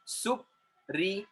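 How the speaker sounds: "Supriya" slowly, Sup, ri, ya, with gaps; three detached beats on "Supriya"; split into syllables. Shown as a demonstration. Teacher counting three syllables, "Supriya" broken apart. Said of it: "So there are three syllables in the word".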